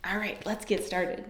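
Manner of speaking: ordinary talk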